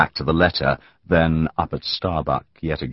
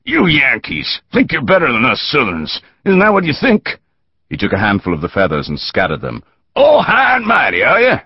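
mocking taunt; 8 semitones higher than usual. A narrator's reading. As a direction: rise into scornful mockery. Thug mocking bound Yankee prisoner.

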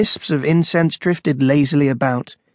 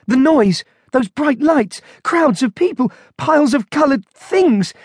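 high-pitched string of appalled exclamations; horrified panic; 10 semitones higher than usual. A narrator's reading. Monk overwhelmed by the supermarket, horrified. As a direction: reel from each sight, pitched high.

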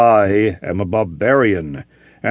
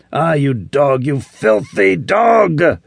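slow, higher, rising furious yell; maddened scream; 4 semitones higher, slower than usual. Acted. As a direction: drag out the insult in rage. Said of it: "His voice rose to a maddened scream".

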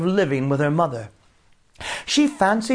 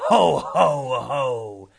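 slow, spaced "ho, ho, ho"; hammed-up monster laughter, falling away; rumbling demon laugh; much slower than usual. A narrator's reading. Demon pops through the window, laughing. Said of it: "a rumble of laughter from the well".

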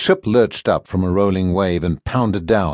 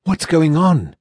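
demanding; 7 semitones higher, slower than usual. Acted. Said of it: "Mara demanded".

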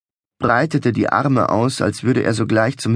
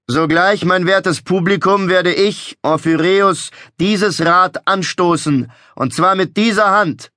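raised, theatrical carnival barker; showman voice; 7 semitones higher, 3 dB louder than usual. A narrator's reading. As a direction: proclaim it like a fairground showman. Showman announcing to crowd.

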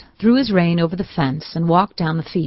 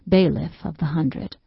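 ordinary delivery, nothing marked

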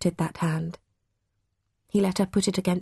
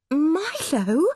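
gasped; 8 semitones higher than usual. A narrator's reading. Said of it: "gasped Mum".

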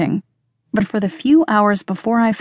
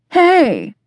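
higher-pitched, falling "hey"; bright greeting; 10 semitones higher, 4 dB louder than usual. A narrator's reading. Gilly greeting a roommate on entering, bright greeting. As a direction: call out a high hello to a roommate.

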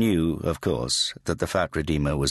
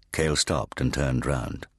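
usual speaking voice

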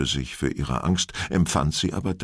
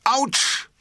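much higher sudden cry of pain; convincing yelp. Acted. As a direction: yelp as if really bitten. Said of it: "schrie Viktor durch und durch überzeugend auf".

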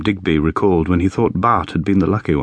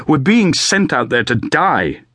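angry; 6 semitones higher, slower than usual. A narrator's reading. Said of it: "Bart's mood changed from despair to anger".

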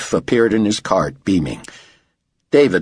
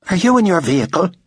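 high, quick, impatient question; demanding; 10 semitones higher, faster than usual. A narrator's reading. Director checking on his driver, demanding. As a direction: fire the question to cut off chatter. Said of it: "David demanded".

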